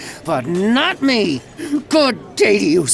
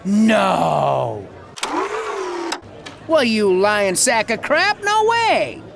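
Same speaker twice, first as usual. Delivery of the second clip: mock disbelief; much slower than usual.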